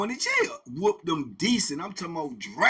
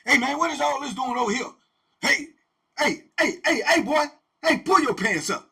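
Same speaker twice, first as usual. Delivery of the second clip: loud, high hollering warden; warden talking slick; 8 semitones higher, 8 dB louder than usual. Retold or imitated. Warden yelling at inmates to pull their pants up, loud and high. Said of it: "he'll get to talking slick".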